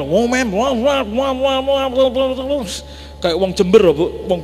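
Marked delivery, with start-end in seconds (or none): 0.0-2.7 higher wah wah chatter imitating a scolding wife; a hint of a grumbling woman; mock nagging. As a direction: mimic her nagging babble. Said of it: "ngomel-ngomel".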